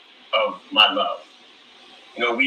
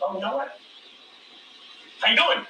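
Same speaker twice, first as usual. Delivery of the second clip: flirty; 11 semitones higher, faster than usual. Retold or imitated. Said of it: "I was like".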